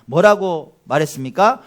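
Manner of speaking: usual speaking voice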